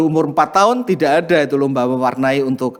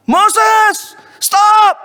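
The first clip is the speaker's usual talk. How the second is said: sharp high shout, much louder; retold shout; 6 dB louder than usual. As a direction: yell at the little brother to stop. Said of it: "dia langsung teriak". (teriakan keras dan tinggi)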